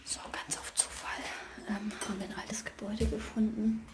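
hushed whisper, afraid of being heard; nervous night vlog whisper. Real, not acted. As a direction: whisper so the neighbours can't hear.

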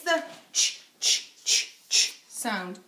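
ch said as a short unvoiced puff, repeated; phonics sound ch. Shown as a demonstration. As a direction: repeat the bare sound ch, ch, ch with no vowel. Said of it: "there it is".

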